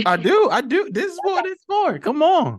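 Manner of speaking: high-pitched, loud burst of eagerness; excited urging. Real, not acted. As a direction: cheer them on loudly and eagerly. Urging guest to continue.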